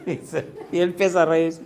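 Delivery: ordinary talk, nothing marked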